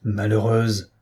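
utter it as a contained threat; frightening. A narrator's reading. Husband turning on his defiant wife, restrained menace. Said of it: "s'écria Cloarec, effrayant".

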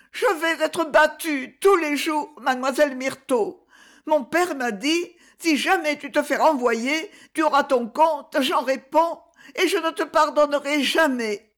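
put-on high child's voice, pleading; a scared little boy repeating his fear; frightened boy. A narrator's reading. Boy telling Myrto about his father, high scared voice. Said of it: "il répétait toujours".

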